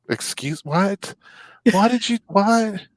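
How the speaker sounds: stunned patient spiraling into panic; shocked disbelief. Retold or imitated. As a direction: climb in panic to a high squeak. Shocked patient hears about ambulance.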